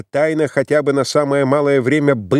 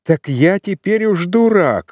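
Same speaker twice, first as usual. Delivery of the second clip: higher, slowed, bitter repeat of дурак; angry retort; 7 semitones higher, 3 dB louder, slower than usual. A narrator's reading. Suitor furious at being fooled, angry. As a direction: hammer дурак home in disbelief. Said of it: "закипел от ярости".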